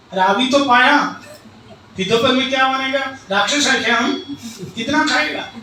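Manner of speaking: loud, high scolding outburst; angry wife. Acted. Wife scolding husband in front of guest, loud and high. Wife's staged quarrel over lunch.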